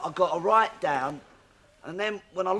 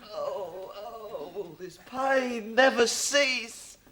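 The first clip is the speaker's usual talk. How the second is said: hammed-up grief; 3 semitones higher, 5 dB quieter, much slower than usual.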